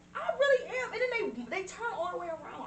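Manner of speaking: high, earnest insistence; excited. Real, not acted. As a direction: insist with rising pitch. Defending fear of owls.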